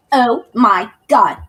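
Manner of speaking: slow, loud shocked exclamation. Real, not acted. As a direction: drag it out in disbelief. Shocked at the photo.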